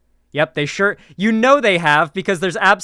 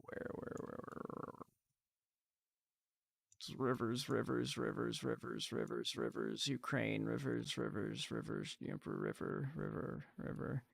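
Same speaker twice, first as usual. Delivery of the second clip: much softer muttered search, partly whispered; muttering to self; 5 semitones lower, 21 dB quieter than usual. Real, not acted. Streamer searching the map for the river, soft muttering to self. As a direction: mumble under your breath while hunting on a map.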